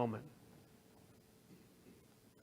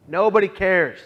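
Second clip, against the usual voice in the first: nobody cares thrown out louder; anguished outburst; 5 semitones higher, 12 dB louder than usual. Retold or imitated. Stepping off the bus, crying out that nobody cares. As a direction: let the despair burst out. Despairing thought after bus.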